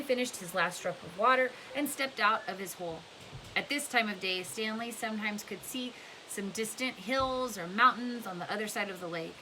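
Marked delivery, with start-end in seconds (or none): none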